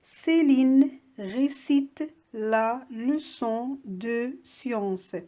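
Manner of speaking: slow reading, word by word; dictation reading. Shown as a demonstration. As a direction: read it out for dictation with gaps between words. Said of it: "je vous lis la phrase maintenant".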